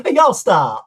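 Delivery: high, loud Southern "y'all"; imitated Southern girl. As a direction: playfully mimic a giggly Southern girl. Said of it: "She'd be like".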